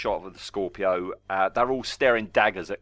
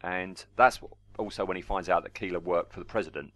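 normal talking voice

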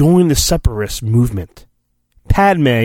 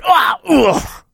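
mock struggle grunts; 11 semitones higher than usual. Droid tackle, grunts.

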